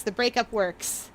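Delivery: usual speaking voice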